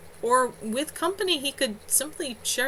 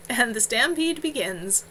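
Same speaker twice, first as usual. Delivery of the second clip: plain reading voice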